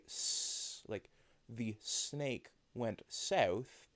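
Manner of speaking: slow, hissy "the snake went south"; unvoiced S. Shown as a demonstration. Streamer teaching voiced versus unvoiced sounds. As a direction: hiss the S with no buzz in the throat. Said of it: "put your fingers up ... and say".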